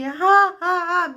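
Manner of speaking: high, wailing fake sobs; hammed-up crying noises; mock drunken sobs. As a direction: sob it out like a drunk.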